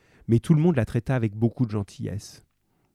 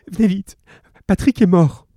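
louder, high, upset plea; crying on phone; 8 semitones higher, 7 dB louder than usual. A narrator's reading. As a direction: beg police through tears. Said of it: "elle dit en pleurant".

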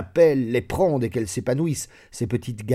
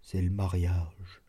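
shy confession; 4 semitones lower, 7 dB quieter, slower than usual. A narrator's reading. Servant confesses he wants marriage.

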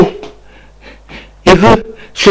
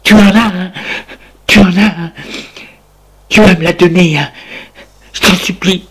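mad pleading; 3 semitones higher than usual. Acted. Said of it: "d'un air fou, lui crie".

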